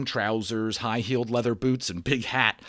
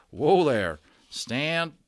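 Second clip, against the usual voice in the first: calling to horse; 6 semitones higher, 5 dB louder, much slower than usual. Acted. Cowboy steadying his horse.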